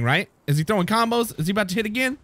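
ordinary talk

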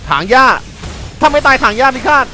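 hyped shout. Real, not acted. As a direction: announce the move like a war cry. Hyped combo call during fight.

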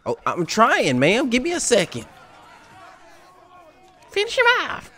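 high, whiny Karen voice. Pretend. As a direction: play a fed-up Karen. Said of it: "Sound like, sound like a Karen".

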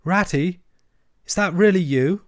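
a hint of a tired, frightened mole; feeble voice. A narrator's reading. Lost mole answering his rescuer, mild feeble voice. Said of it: "came a feeble voice".

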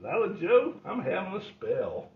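lightly put-on sick groan; faking a spell. Grandpa faking illness again.

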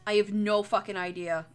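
ordinary delivery

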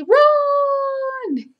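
high, loud cry of panic; panicked bug shout. Acted. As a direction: squeal it like a fleeing bug. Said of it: "the aphids see the ladybug and say".

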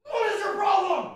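very high, freaked-out squeal; startled high-pitched outcry; spooked protest. Real, not acted. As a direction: squeal it out in fright. Spooked, confronts whatever scared him.

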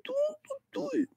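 very high, soft whiny troll. Acted. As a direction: squeak it out like a sulky troll. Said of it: "That's me doing Jocko doing the troll voice".

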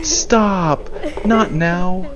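high, drawn-out protest; exasperated plea. Real, not acted. Player begging the game to stop, high plea.

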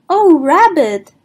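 high, loud surprised "Oh, Rabbit"; surprised Pooh. Acted. Pooh surprised to see his friend, high and loud. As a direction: gasp out his name in delight.

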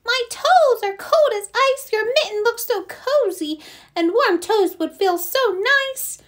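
high-pitched little mouse pleading; teeny tiny mouse. Acted. Little mouse begs for room, teeny tiny voice. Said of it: "in a teeny tiny voice".

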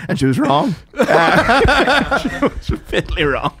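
giggling as he tells it; laughing. Real, not acted. Telling his mate the punchline through laughter.

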